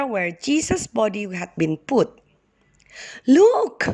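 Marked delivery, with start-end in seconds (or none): 3.3-3.8 high, loud cry of Look!. Acted. A woman crying out to the others at the tomb, high and loud. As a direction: call the others over in surprise. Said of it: "cried one of the women".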